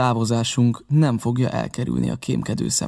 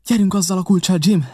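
high, louder, urgent order; pressing call to get moving; urgent command; 7 semitones higher, 5 dB louder than usual. A narrator's reading. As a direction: hurry him along, voice raised. Mother urges Jim to hurry.

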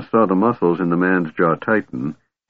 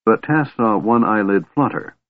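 ordinary delivery

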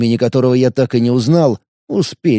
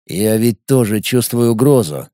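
plain reading voice

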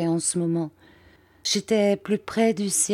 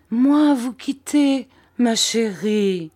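higher, louder, slow outcry of protest; a loyal old servant protesting, slow and loud; indignant cry; 5 semitones higher, 4 dB louder, slower than usual. A narrator's reading. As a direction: cry it out, shocked at the very idea. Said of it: "s'écria Peggotty".

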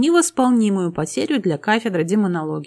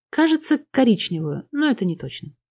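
usual speaking voice

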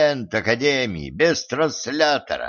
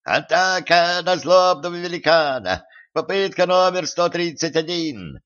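high-pitched tiny knight's battle cry; squeaky shouting; 7 semitones higher than usual. A narrator's reading. Tiny knight challenging a giant, squeaky yelling. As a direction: yell it in a squeaky little voice. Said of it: "писклявым голоском заорал".

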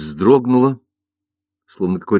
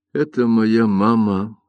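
plain reading voice

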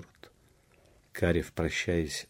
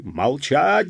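high, loud order for silence; angry command; 8 semitones higher, 9 dB louder than usual. A narrator's reading. Police chief silences the peasants, angry command. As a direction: shout them down, fist on the table. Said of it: "застучал кулаком исправник".

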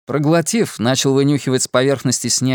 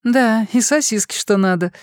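put-on higher, bubbly girlish voice; chirpy young woman, pleased with food; bubbly sorority girl; 10 semitones higher than usual. A narrator's reading. Fortuna voiced, higher pitch. (игривая весёлая девушка)

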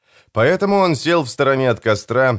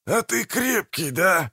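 high, soft, slow astonishment; surprised; 9 semitones higher, 4 dB quieter, slower than usual. A narrator's reading. Manfred sees the broken man still alive, surprised. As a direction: marvel quietly, pitch right up. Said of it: "удивлённо проговорил Манфред".

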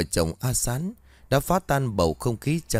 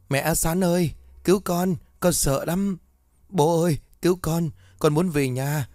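put-on a frightened little kid screaming for mum and dad; terrified child crying; 7 semitones higher than usual. A narrator's reading. Children tied to stone pillars cry to parents, high terrified wail. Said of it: "miệng không ngừng gào thét".

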